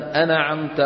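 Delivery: أنعمت as "ana'amta", ن given a vowel; wrong bounced noon. Retold or imitated. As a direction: mimic the mistake of echoing ن in أنعمت. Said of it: "فلا يصح أن يقول الإنسان".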